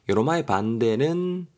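ordinary delivery

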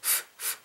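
"f, f" as soft voiceless puffs; scraped lip-teeth sound. Shown as a demonstration. Teacher isolating the final sound of stove, quiet blown "f". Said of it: "긁으시면 됩니다".

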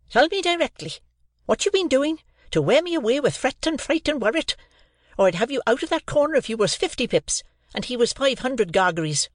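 put-on a harsh sister berating a boy; scolding sister. A narrator's reading. Mrs Joe scolds Pip.

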